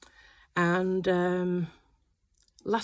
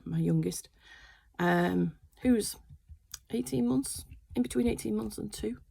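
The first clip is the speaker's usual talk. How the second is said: usual speaking voice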